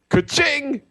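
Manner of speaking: high, gleeful ka-ching; cash register ka-ching. Retold or imitated. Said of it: "Dallas is like".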